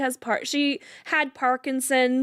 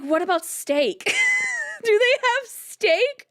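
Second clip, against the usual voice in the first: laughing through quote; 12 semitones higher than usual. Real, not acted. Quoting grandma while laughing.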